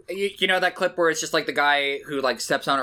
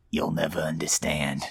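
low brooding mutter; 12 semitones lower, 5 dB quieter than usual. Acted. Guy brooding.

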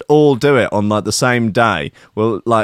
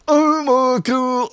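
much higher, slow mock awe; awed onlooker; 13 semitones higher, much slower than usual. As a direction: stretch it out in hammed-up wonder. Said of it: "People were like".

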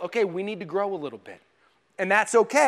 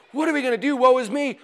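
mock lament; 5 semitones higher, 4 dB louder, faster than usual. Mock lament when life hits.

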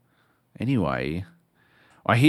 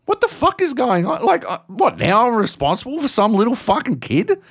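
much higher incredulous inner voice; 11 semitones higher, 3 dB louder than usual. Retold or imitated. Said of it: "I'm like".

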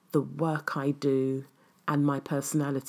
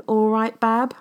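a terrible Midlands "all right, Bab?", higher and louder with a rising question; Midlands accent; 8 semitones higher, 9 dB louder than usual. Retold or imitated. Greeting her darling Danny in a mock Midlands accent. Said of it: "my really terrible, uh, Midlands accent".

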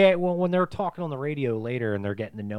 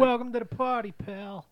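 Bruce Willis movie line; 13 semitones higher than usual. Retold or imitated. Quoting the famous movie line.

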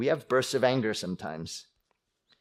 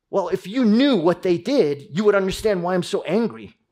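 raised, louder self-justifying complaint; mock indignant protest; 7 semitones higher, 6 dB louder than usual. Preacher voicing an angry person justifying himself, indignant. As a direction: act out someone defending their rage.

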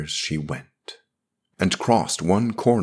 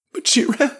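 very high, loud pleading; impassioned priest; 5 dB louder than usual. Acted. Priest pleads as she shrugs him off, impassioned. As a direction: press the warning, voice pitched right up.

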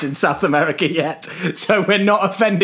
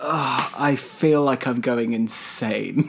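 slow, low, softer groaned complaint; weary exasperation; 4 semitones lower, 5 dB quieter, slower than usual. Real, not acted. Co-host worn down by host's excuses, weary groan.

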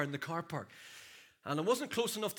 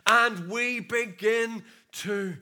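loud, rising pulpit preaching; full-force preaching; 2 semitones higher, 6 dB louder than usual. Acted. Preacher rousing the congregation, full-force preaching. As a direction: preach it out to the whole room.

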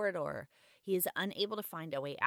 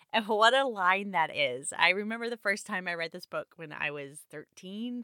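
usual speaking voice

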